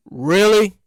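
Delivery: low-pitched, unimpressed really; mock disbelief. Podcaster told of yet another cousin, low doubtful reaction. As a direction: drop the pitch in deadpan doubt. Acted reaction to claimed relatives.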